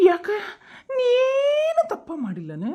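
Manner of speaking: high, slow sobbing plea; crying question. Acted. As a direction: cry it out as a hurt mother. Acted quarrel, mother in tears. (ಅಳುತ್ತಾ ಕೇಳುವುದು)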